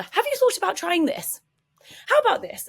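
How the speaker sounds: high, loud, pushy suggestions; over-eager rescuer. Acted. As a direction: jump in eagerly with fixes, pitch climbing. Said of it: "We jump straight in with the".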